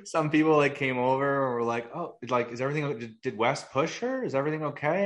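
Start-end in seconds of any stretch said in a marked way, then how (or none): 1.9-5.0 higher mock worried voice; imitating fussy concerned onlookers; lightly voiced worried neighbours; concerned bystanders.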